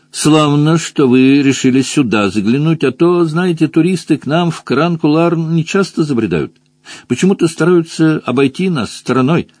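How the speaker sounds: high-voiced, welcoming rabbit local; chatty rabbit. A narrator's reading. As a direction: chat to the visitors in a high rabbit voice. (разговорчивый кролик, высокий голос)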